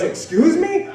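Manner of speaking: high, incredulous question; affronted mother. Retold or imitated. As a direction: shoot up in pitch, offended. Mother affronted by son's retort.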